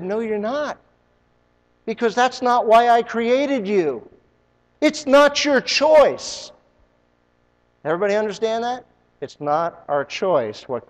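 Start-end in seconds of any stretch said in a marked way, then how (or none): 0.0-6.5 rising, slowing, and louder by the end; a lightly voiced God, insistent; God's firm reply. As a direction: lay down God's word, slow and firm. God's voice answering the quitter.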